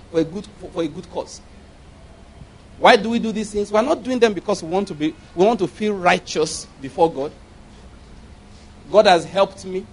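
ordinary talk, nothing marked

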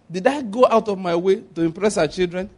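ordinary talk

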